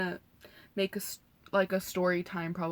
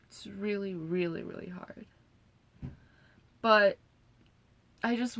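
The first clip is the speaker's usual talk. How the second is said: emotional. Real, not acted. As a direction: voice sinking to a near whisper. Recalling her father's arrest.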